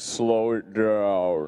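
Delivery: very slow, dropped low; slowed-down deep voice. Shown as a demonstration. Co-host teasing host about slowed speech, deep draggy voice. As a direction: drag it out like a slowed recording. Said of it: "your voice gets deeper".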